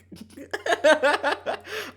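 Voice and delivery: loud high burst of laughter; high cackle. Real, not acted. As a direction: crack up at your own joke. Laughing mid-rant.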